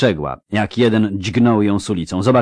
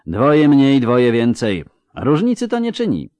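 higher, louder sneering taunt; mocking taunt; 6 semitones higher, 5 dB louder than usual. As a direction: taunt them with contempt. Villain mocks the rescuers. (drwiąca, wyższa kpina)

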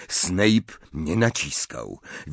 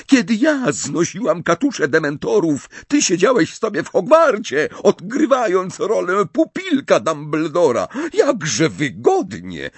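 much higher and louder, enraged; furious shouting; 11 semitones higher, 5 dB louder than usual. Acted. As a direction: rant at Snape like a madwoman. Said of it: "wrzasnęła tak rozwścieczona".

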